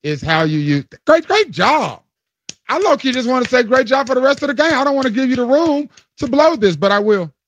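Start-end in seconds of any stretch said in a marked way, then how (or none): 1.0-7.3 high-pitched, excited congratulations; impressed praise. Real, not acted. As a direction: gush praise at a much higher pitch. Delighted praise for surprise correct answer.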